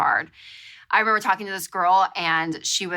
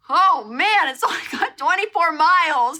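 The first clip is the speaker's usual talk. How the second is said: mechanic's dismay; 8 semitones higher, 4 dB louder than usual. Retold or imitated. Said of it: "He was like".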